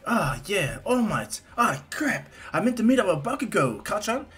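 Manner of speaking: put-on high boy voice, sudden realization; Izuku. Boy remembering he stood up his friend, high dismay. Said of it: "Izuku's like".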